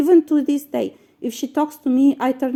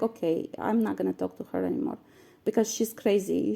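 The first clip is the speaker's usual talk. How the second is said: quiet resigned thought; 5 semitones lower, 11 dB quieter, faster than usual. Retold or imitated. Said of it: "I was like".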